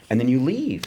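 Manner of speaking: ordinary talk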